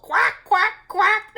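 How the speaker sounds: high, loud duck quacks; quacking ducks. A narrator's reading. Ducks call out in the wind, quacking. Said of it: "they called".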